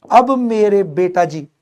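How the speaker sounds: ordinary talk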